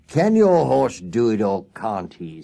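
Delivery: loud, slow, bullying demand; blustering man. A narrator's reading. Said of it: "said the blustering man".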